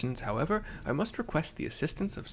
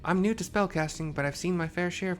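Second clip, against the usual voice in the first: higher female soldier; 6 semitones higher, 4 dB louder than usual. Acted. Voicing a female sergeant.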